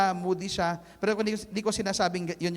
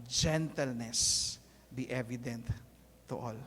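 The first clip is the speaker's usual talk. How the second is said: gentle hushed reading; 10 semitones lower, 10 dB quieter than usual. Shown as a demonstration. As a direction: let it sink in, soft and gentle. Pastor rereads the verse gently.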